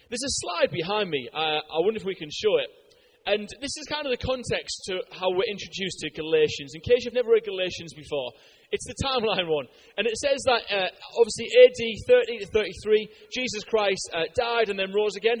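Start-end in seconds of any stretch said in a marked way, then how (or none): none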